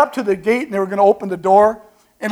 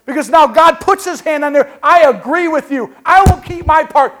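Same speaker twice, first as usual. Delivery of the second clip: louder and higher, preaching God's promise; 7 semitones higher, 5 dB louder than usual. Acted. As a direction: proclaim it with conviction.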